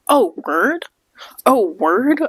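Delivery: high, rising double-take question; retold disbelief, swooping up at the end; incredulous surprise. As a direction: act out a puzzled, surprised realization. Acting out surprise at wrong line.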